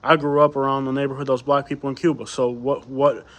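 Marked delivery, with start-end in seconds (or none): none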